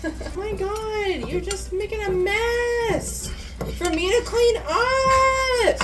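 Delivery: high, slow, drawn-out complaint; exasperated scolding. Pretend. Scolding partner for making a mess, playful exasperation. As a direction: draw out the nagging, rising in pitch.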